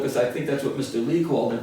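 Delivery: ordinary delivery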